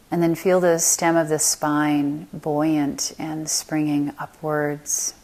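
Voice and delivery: unhurried meditation voice, long silences between phrases; guided meditation. Acted. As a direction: lead them calmly through the body, letting phrases settle.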